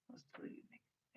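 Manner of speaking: normal talking voice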